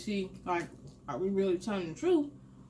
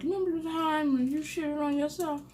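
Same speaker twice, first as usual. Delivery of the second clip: high, playful imitated teasing; teasing reminder; 7 semitones higher than usual. Teasing a cousin about an old mishap, high-pitched. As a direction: tease in a raised voice.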